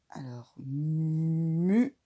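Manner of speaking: slow whispered mu; whispered mu, drawn out; syllable mu. Shown as a demonstration. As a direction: breathe out the syllable mu slowly.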